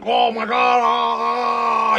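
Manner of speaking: long drawn-out panicked wail; mock terror. Son grabbed by his angry father, mock terrified wail. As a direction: cry out in hammed-up fright.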